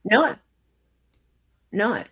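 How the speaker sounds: ordinary talk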